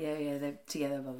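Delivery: ordinary talk, nothing marked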